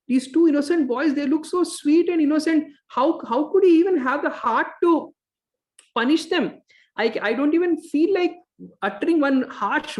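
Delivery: high, pleading plea of a tearful wife; a wife near tears, pitched high and hurt. Retold or imitated. Wife upset over the punished boys, high and tearful. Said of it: "her heart melted and she started crying".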